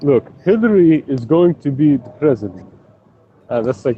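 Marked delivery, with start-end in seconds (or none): 0.0-2.7 bad Jamaican accent, a bit higher; jokey Jamaican impression. Imagining the Jamaican guy's reply in a bad Jamaican accent. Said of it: "the worst Jamaican accent ever".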